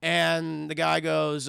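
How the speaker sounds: normal talking voice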